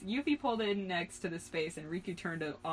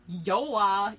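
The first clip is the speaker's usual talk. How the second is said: loud short yip; yipped; 7 dB louder than usual. A narrator's reading. Boy showing off his made-up word, yipped. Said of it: "yipped Sora".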